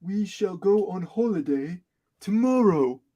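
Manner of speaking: slow, drawn-out cartoon dad voice; pompous cartoon father, taking his time; Daddy Pig voice. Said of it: "I'm Daddy Pig".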